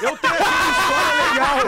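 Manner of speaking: shouted over laughter; loud laughing announcement. Real, not acted.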